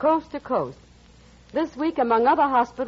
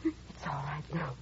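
soothing; 8 semitones lower, 15 dB quieter than usual. Acted. Comforting sobbing daughter.

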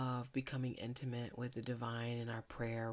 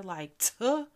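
a dismissive louder tuh; mock scoff, higher and louder; 6 semitones higher, 9 dB louder than usual. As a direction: scoff at the idea. Scoffing sound.

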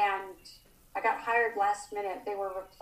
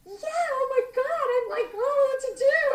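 much higher and slow, starstruck squeal; giddy yelling; 4 dB louder than usual. Retold or imitated. On the phone with roommates, much higher giddy starstruck squealing. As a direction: swoon and squeal over the star. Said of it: "we're, like, yelling".

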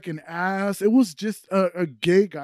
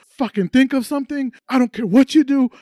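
raised, loud mock insistence; imitating demanding woman; 6 semitones higher, 6 dB louder than usual. Woman urging her partner during sex, high loud mock demand. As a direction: order it urgently in her voice. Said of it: "and she goes".

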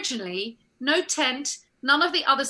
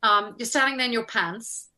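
ordinary talk, nothing marked

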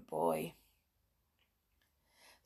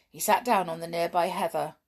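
usual speaking voice

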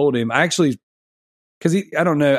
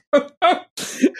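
laughing. Real, not acted. Laughing at his own story.

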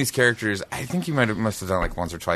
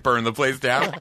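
normal talking voice

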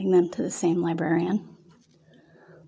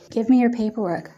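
matter-of-fact; 4 semitones higher than usual. A narrator's reading. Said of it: "stated very matter-of-factly".

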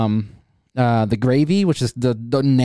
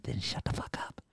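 breathy, unvoiced threat; menacing whisper; 3 semitones lower, 16 dB quieter than usual. Haunt doctor threatening his captives in a whisper. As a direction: hiss it right into their ear.

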